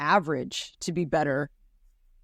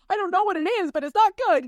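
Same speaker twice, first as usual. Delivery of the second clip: put-on much higher little-kid voice; imitating a small boy; 13 semitones higher, 4 dB louder, faster than usual. Father quoting his son about a bad feeling, high childlike voice. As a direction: squeak it out like a confused six-year-old. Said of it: "He's like".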